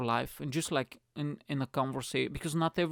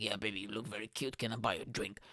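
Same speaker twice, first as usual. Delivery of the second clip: lightly voiced sleazy guy, a bit lower and softer; a hint of a sleazy pickup line; 4 semitones lower, 8 dB quieter, faster than usual. Guy chatting up a girl at the bar, low sleazy voice. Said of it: "like a sleazy guy".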